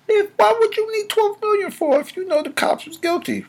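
very high, squeezed incredulous voice; high-pitched mock disbelief; mocking question. Real, not acted. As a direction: shoot way up high to challenge it. Mocking question about the settlement.

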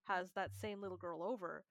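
normal talking voice

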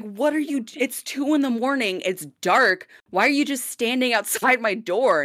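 high-pitched, stumbling demand for explanation; retold bewildered challenge. Lodger questioning roommate standing outside door, bewildered challenge.

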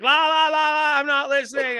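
high sing-song blocking-out chant; childish taunting. Retold or imitated. Imitating sister ignoring an argument, high childish taunt. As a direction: imitate a kid drowning someone out. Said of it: "I got the child treatment".

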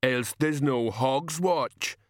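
big simple man, stubbornly insistent; rumbling giant. A narrator's reading. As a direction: drag each word out heavily. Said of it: "Banjo rumbled".

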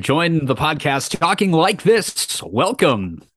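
hammed-up podcast intro, imitating a host; mock announcer voice. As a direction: draw it out like a hammy announcer. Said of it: "Speaking of announcer voices".

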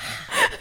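high, breathy sigh breaking into a laugh; mock sigh. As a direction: sigh it out, then crack up. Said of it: "literally all I did was one sigh".

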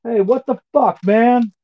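loud, high-pitched indignant shout; guard's shout. Acted. Guard shouts at his attacker, shouting. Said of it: "And shouts".

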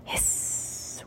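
very soft voiceless hiss; hose hiss. A narrator's reading. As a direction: let air leak out of a hose. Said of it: "went the hose".

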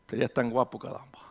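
soft, laughing murmur under the breath; laughing aside. Real, not acted. Pastor laughing softly to himself about looking handsome. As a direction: chuckle it quietly to yourself.

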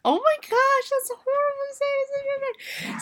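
high, squeaky scandalized voice; mock shocked gasp. Audience reacting to a crude line, squeaky and scandalized.

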